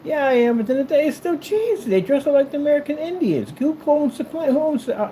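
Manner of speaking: very high, exasperated comeback; heated retort. Acted. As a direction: argue back in a high strained pitch. Arguing back at imagined patriot critic.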